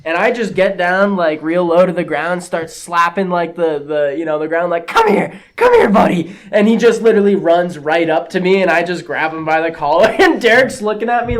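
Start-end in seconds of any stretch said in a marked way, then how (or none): 4.8-6.3 much higher sing-song pet call; coaxing a dog. Retold or imitated. Crouching mover calling the dog to him, high sing-song call.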